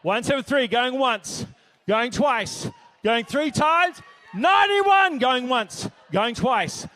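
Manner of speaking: loud, rapid-fire auction call; auctioneer calling bids. Acted. Auctioneer at a charity gala, calling out bids loudly.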